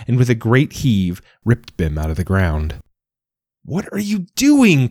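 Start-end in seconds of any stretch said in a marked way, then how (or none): 3.6-4.9 much higher and slow, aghast; alarmed cry. A narrator's reading. Rose crying out as the penguin rips up Bim, alarmed. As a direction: cry out in horror at the uprooting. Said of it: "cried the rose".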